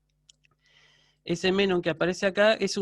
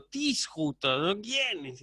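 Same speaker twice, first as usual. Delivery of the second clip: pregunta con asombro ligero y entonación marcada; con entonación expresiva; 3 dB quieter, slower than usual. Shown as a demonstration. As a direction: leerlo con expresividad, sorprendido a medias. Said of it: "si le pongo la entonación". (profesor muestra cómo leer con expresión, levemente asombrado)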